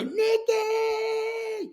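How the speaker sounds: high, calling mother's shout out the window; mom calling out. Retold or imitated. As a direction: holler "Nicky" like mom from the window.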